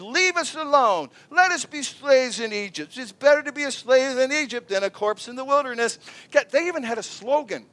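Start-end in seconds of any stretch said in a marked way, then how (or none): 0.0-6.0 whining complaint. Retold or imitated. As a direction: whine it like a sulking crowd. Israelites whining to Moses.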